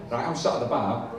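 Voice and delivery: ordinary delivery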